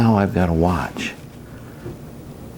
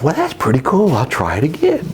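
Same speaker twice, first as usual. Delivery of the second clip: higher, pleased little inner voice, pitched way up; 10 semitones higher than usual. Retold or imitated. Husband voicing his own thought after the meeting, high and pleased. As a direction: voice your own smug thought lightly. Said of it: "Well, I felt".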